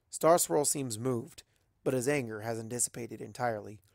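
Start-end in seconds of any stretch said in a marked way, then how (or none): none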